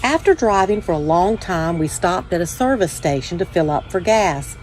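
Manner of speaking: ordinary delivery, nothing marked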